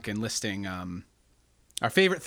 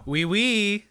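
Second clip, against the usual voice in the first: fake French; 7 semitones higher, 4 dB louder than usual. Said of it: "That's not French".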